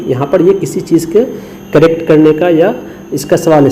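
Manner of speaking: usual speaking voice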